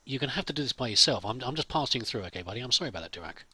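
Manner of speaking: ordinary delivery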